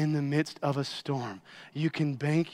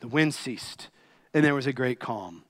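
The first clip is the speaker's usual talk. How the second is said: ordinary talk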